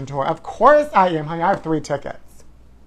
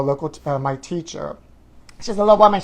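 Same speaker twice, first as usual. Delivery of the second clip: ordinary talk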